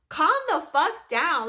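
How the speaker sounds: forceful acted command; retold stern order. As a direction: imitate an exasperated manager cutting someone off. Greg ordering her to calm down.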